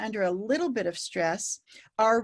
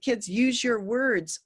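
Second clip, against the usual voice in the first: teacher reminder; slower than usual. Retold or imitated. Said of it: "we even say things like".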